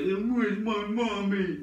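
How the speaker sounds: put-on high, drawn-out baby animal voice; baby elephant. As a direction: stretch it out like a lost little elephant calf. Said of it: "alive little elephants going".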